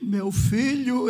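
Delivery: lightly voiced frail, trembling woman, drawn out; faint faraway voice. Sick sister greets her visitor, in a faraway voice. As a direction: imitate a sick woman's slow, distant voice. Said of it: "ela com a voz bem longe".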